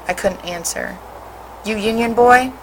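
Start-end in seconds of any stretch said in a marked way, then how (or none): 1.7-2.5 higher, mocking taunt; jeering. A narrator's reading. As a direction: jeer at him, sneering. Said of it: "one jeered".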